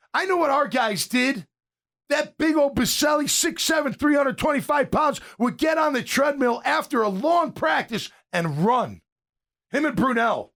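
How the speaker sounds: high, shouted hype about a big teammate; fired-up praise for a teammate's work ethic; excited shout. Real, not acted. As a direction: shout his praise with awe. Hyping up a teammate's extra work.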